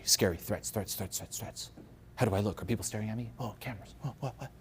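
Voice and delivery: hushed, anxious muttering to oneself; fearful. Acted. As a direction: mutter nervously, spotting threats everywhere. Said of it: "sitting in a state of fear".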